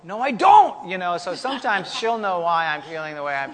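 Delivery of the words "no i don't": loud, high denial; mock defensive shout. Husband denying he needs time alone, loud and defensive. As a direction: fire it back loud, half joking.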